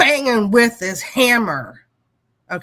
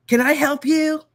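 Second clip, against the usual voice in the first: higher, challenging can I help you; imitated snotty helper, pitched up; mock snotty helper; 8 semitones higher, 4 dB louder than usual. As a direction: sneer it like a smug young helper. Helper's confrontational quote.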